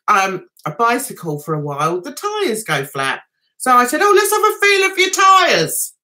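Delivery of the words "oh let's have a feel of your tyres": higher, louder sing-song offer; chirpy offer. Retold or imitated. Offering to feel the neighbour's tyres, cheeky higher voice. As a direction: offer it brightly with a cheeky lift.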